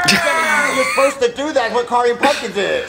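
frustrated dad shouting; exasperated yelling. Acted. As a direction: shout it, fed up. Dad yells back at Jeffy.

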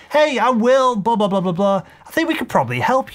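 easygoing conversational sales pitch; conversational demo. Shown as a demonstration. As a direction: toss it off lightly like a chat. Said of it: "tonality-wise, you wanna keep this very conversational".

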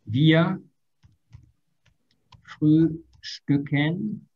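frühstücken stretched out slowly; very slow demo. Shown as a demonstration. Teacher drilling the class on the verb, very slow. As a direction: draw every syllable out. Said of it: "Ya vamos a entrenarlo".

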